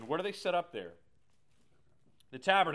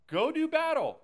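loud, high call to battle; a pep-talk yell to get moving; rallying shout; 12 semitones higher, 10 dB louder than usual. Retold or imitated. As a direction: send them off to fight with a roar. Joshua pushing people to fight.

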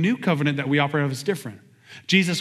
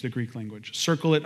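normal talking voice

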